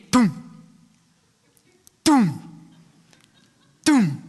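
imitating a Roman candle going boom, boom, boom; three spaced-out mock explosions; firework booms. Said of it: "and you lit it".

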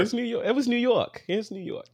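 high-pitched, put-on New York accent. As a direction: say it like a playful New Yorker. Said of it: "Was that New York or".

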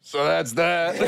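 slow, drawn-out fake German; German accent. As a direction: wrap it up slowly in a German accent. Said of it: "keep this charade on".

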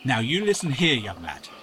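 put-on old villager scolding a stranger, loud; stern rebuke. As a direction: put the young lad in his place.

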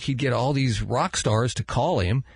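ordinary talk

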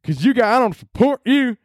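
very high, mock-outraged southern yokel; southern accent. Angry commenter withdrawing support from the company, southern accent. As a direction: whine it out in a hammed-up southern accent. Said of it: "the inbreds are yelling again".

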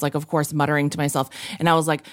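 ordinary talk, nothing marked

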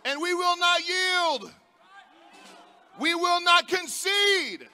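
loud, high rally shouting; roaring rallying speech. Real, not acted. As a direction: rouse the crowd, full force.

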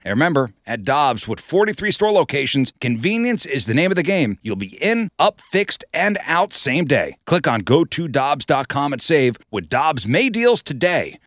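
upbeat radio ad announcer; ad read. Acted. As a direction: sell it like a radio spot.

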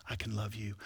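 a hushed whisper of I can love you. Pretend. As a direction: breathe it, no voice. Whispered line of inner monologue.

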